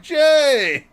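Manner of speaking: high, loud call of a name; calling greeting. Retold or imitated. Customers walking in and greeting Jay, loud. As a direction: hail a friend across the store.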